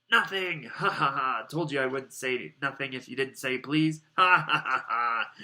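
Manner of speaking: loud, crowing mockery with ha-ha laughs; gloating laughter. A narrator's reading. Peeves has tricked Filch, gloating laughter. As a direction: crow it loudly, laughing at your victim.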